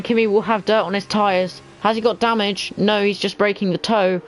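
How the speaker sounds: normal talking voice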